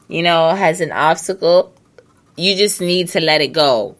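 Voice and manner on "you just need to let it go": normal talking voice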